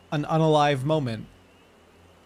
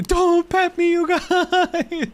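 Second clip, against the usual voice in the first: high squeal; 13 semitones higher, 5 dB louder, much slower than usual. Real, not acted. Chat comment reaction.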